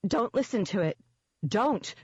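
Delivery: rising, pleading panic; frightened plea. Acted. Rachel begs Nick not to trust the demon, frightened.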